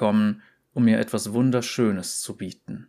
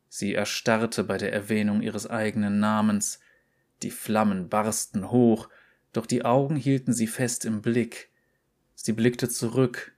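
ordinary delivery, nothing marked

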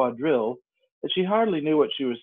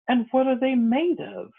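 curious; 13 semitones higher than usual. A narrator's reading. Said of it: "tone of great curiosity".

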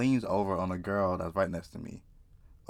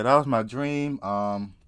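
normal talking voice

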